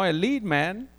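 usual speaking voice

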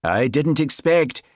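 slow, high, loud Highland protest; flustered Highland accent. A narrator's reading. Sergeant explaining himself to new constable, flustered Highland accent. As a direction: falter in a broad Highland accent. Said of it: "His Highland accent always grew more pronounced when he was flustered".

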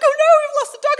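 high, loud mock wail; panicking child. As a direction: imitate a daughter in a flap. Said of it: "she's gonna panic".

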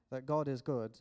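ordinary talk, nothing marked

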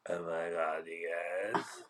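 nasal upspeak influencer parody; annoying teenage-girl voice. Shown as a demonstration. Cohost demonstrates the voice partner hates, annoying teenage-girl voice. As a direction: put on a grating Kardashian drawl. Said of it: "tell the people how you say it".

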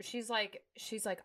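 usual speaking voice

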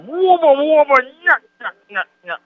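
goofy comedy-trio whoop and nyuk; Three Stooges nyuk-nyuk. Retold or imitated. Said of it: "what the Three Stooges say".

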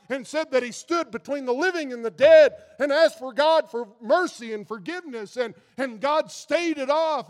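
fiery preaching. Acted. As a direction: preach hellfire with full force. Preaching with rising force.

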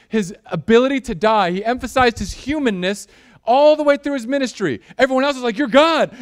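high, loud pulpit preaching; loud, high, driving sermon delivery; preaching at full force. Acted.

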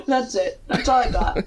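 normal talking voice

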